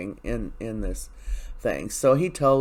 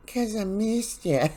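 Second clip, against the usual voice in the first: much higher, slow, sugary fake sweetness; mock fake Belle; 9 semitones higher, slower than usual. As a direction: drawl it out like an obvious lie. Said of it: "she's so fake".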